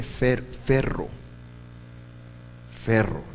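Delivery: "ferro" with a soft hissed F, repeated slowly. Shown as a demonstration. Teacher shows the letter without dagesh sounding as F. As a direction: breathe the F of "ferro". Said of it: "toma el sonido de F".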